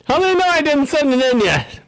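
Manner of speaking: high pitched mock outrage; mock indignant. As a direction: squeak out the fake indignation. Acting out the survey-taker's outrage.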